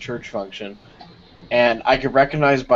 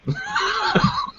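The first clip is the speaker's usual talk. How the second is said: tiny, very high fart squeak; imitated fart; 6 dB quieter than usual. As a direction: squeeze out a soft, squeaky mock fart. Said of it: "I just heard, like, this".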